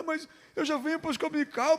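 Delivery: mock a girl objecting to her pastor; lightly put-on girlish protest.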